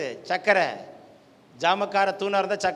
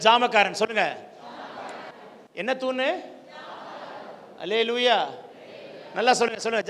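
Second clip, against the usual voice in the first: ordinary talk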